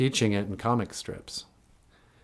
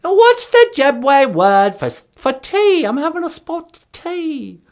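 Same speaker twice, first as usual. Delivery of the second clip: put-on very high, loud posh British old lady; squeaky mock Queen Mum; Queen of England; 10 dB louder, slower than usual. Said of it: "Queen Elizabeth?".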